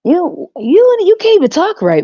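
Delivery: high, loud indignant squawk; sharp high scolding burst; indignant outburst. Acted. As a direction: call them out in disbelief. Scolding the church folk.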